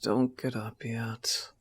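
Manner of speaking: hushed, slow morning whisper; soft whisper. Acted. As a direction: whisper to keep him in bed. Sleepy morning, keeping partner in bed.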